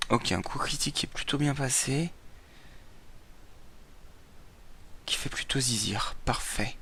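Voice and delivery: hushed whisper so nobody wakes. Real, not acted. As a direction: keep it a soft whisper. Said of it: "si je parle tout bas aussi".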